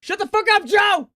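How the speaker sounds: high, loud snap at a friend; angry yell. Real, not acted. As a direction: bark it at him. Yelling at teasing friend.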